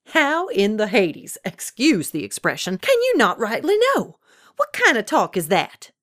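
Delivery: loud incredulity leaping high in pitch; exasperated disbelief. A narrator's reading. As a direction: scold him, astonished at the answer. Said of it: "Ada Mae barked in disbelief".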